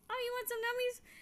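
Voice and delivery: put-on high cooing baby talk; baby talk to cat. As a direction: coo it to the cat. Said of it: "I'm like".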